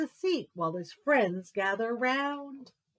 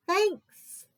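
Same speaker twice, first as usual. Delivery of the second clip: a sudden high, louder thanks; 10 semitones higher, 5 dB louder than usual. Acted. Bear thanking Mouse for pie, high and bright. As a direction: pop the word up high and bright.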